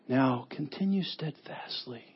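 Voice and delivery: prim, quiet, stiff reading over a cup of tea; stiff and proper. Shown as a demonstration. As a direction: say it softly like polite tea talk. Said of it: "sounds so proper ... over a cup of tea".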